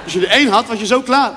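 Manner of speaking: ordinary talk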